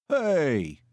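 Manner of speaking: high, falling complaint; mildly put-out whine; complaining. A narrator's reading. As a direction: call it out as a grievance. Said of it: "he complained".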